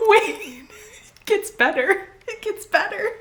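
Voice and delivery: high, laughing squeal of words; slow and high, breaking up laughing; helpless laughter. Real, not acted. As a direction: get it out through the giggles. Too amused to retell the scene.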